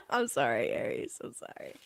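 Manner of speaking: breathy whispered sorry; a sheepish whispered sorry; whispered apology. Real, not acted.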